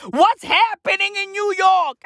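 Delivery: high-pitched incredulous holler; exasperated shout. Acted. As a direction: cry out in comic disbelief. Exasperated rant about New York.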